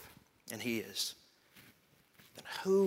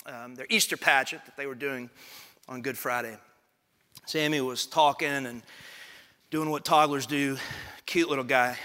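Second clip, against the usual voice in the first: ordinary delivery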